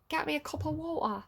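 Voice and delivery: a quick London accent. Shown as a demonstration. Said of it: "that's kind of London".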